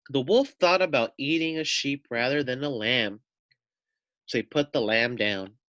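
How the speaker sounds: storybook narration. A narrator's reading. Teacher reading a picture book to children, animated narration. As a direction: read it out to the children like a bedtime story.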